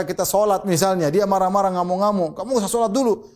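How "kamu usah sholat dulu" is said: higher-pitched scolding parent; mock angry parent. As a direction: mimic a parent forbidding prayer. Angry parent quoted in example.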